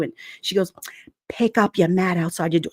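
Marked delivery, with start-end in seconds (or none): none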